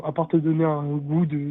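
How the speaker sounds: ordinary delivery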